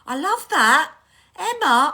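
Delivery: genuinely thrilled and loud; excited gush. Real, not acted. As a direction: burst out with real delight. Moved by the mum's birthday message.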